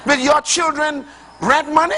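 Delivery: high, ringing pulpit voice; climbing sermon cadence, driving each phrase; full-force preaching. Acted. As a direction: preach it hard to the congregation. Preaching continues after reply.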